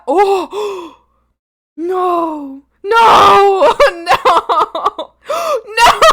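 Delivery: loud anguished no; crying out. Real, not acted. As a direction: wail it in shock. Reacting in shock to video.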